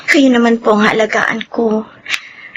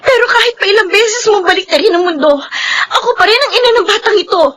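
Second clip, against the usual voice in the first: umiiyak na paglaban; 9 semitones higher, faster than usual. Acted. Ina ipinaglalaban ang anak.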